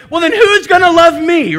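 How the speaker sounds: loud, high mock plea; anxious inner cry. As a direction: cry out like a worried heart afraid of being left unloved. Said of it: "a part of us there that wants to go".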